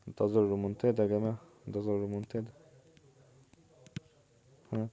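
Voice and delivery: usual speaking voice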